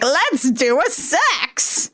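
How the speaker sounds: slow, drawn-out goblin voice; slow creepy imp. Retold or imitated. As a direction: stretch it out like a lecherous imp. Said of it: "the imp is like".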